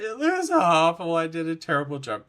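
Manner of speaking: exaggerated dismay, easing off; dismayed outburst. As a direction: blurt out dismay at your own work. Retells dismayed first reaction to work.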